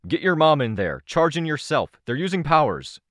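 urgent shouted orders. A narrator's reading. Dad sending mom and daughter to charge, urgent shouting.

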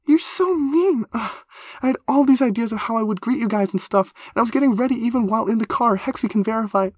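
very high, loud, aggrieved complaint; excitable high character voice, upset. Acted. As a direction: whine at your friends, mortified.

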